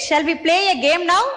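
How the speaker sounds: ordinary talk, nothing marked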